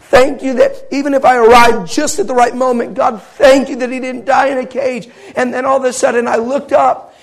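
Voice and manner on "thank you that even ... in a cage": loud, raised-pitch impassioned prayer; tearful thanks, retold. Father prays over the boy he held, impassioned tearful thanks. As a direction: cry out thanks as if weeping. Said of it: "just weeping over this little boy and saying".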